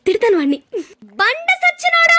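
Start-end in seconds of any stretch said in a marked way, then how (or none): 1.2-2.2 high, drawn-out yell; yelled curse. Acted. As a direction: yell the insult and hold the last vowel. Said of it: "ఇప్పుడు ఇంత అరవలేదు తెలుసా".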